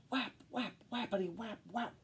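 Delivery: playful whapping noises; sound effect. A narrator's reading. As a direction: tap out the whaps rhythmically.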